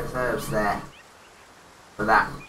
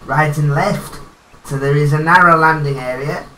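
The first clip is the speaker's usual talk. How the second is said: golf commentator; 4 dB louder than usual. Acted. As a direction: call the shot like a TV golf announcer. Player narrates his golf game.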